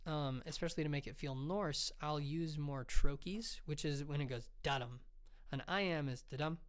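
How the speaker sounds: normal talking voice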